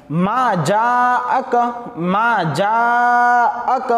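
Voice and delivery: very slow, stretched melodic recitation; slow Quranic chant with long vowels. Shown as a demonstration. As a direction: draw out every syllable like tilawat. Shows long madd on jaak.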